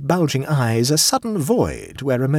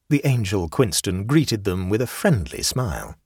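ordinary delivery, nothing marked

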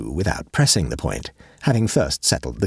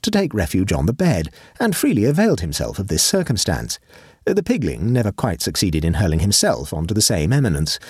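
ordinary delivery

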